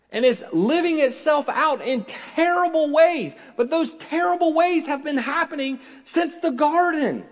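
high, loud preaching at full force; impassioned preaching. Acted.

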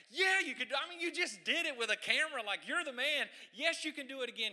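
loud, high, excited cheer; cheering crowd. Retold or imitated. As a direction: yell it like a fan losing their mind. Crowd cheering the tightrope walker.

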